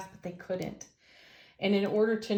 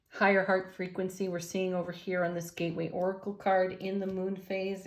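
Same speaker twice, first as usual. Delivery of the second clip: ordinary talk, nothing marked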